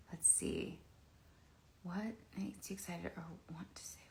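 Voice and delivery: hushed reading near a sleeping dog; whispering. Real, not acted. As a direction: whisper the question softly.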